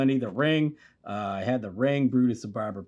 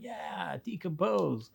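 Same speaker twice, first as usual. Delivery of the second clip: softer, higher imitated kid; kids' echoing response; 4 semitones higher, 8 dB quieter, much slower than usual. Kids answering the monster toys, soft imitated echo. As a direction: echo the monster small and hushed. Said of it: "And we'd be like".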